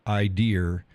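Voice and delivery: "I dear" with an R on the end; added final R. Retold or imitated. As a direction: say "idea" as "I dear". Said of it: "and drop the R".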